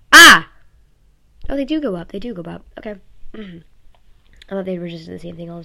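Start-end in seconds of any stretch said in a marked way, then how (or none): 0.1-0.5 sudden high, very loud shout; loud test yell. Shown as a demonstration. Testing sound waves on the mic, one huge high yell. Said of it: "what would happen if I yelled really loudly?". 1.5-2.1 high, loud delighted surprise; surprised. Real, not acted. Watching the sound waves spike, high and loud amazement.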